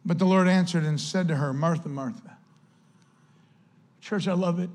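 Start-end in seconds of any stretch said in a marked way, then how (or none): 1.5-2.4 soft, compassionate and low; a soft, compassionate call to Martha. Shown as a demonstration. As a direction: say the name gently, with compassion. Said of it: "meant biblically to show a soft tone".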